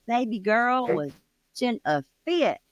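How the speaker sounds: ordinary delivery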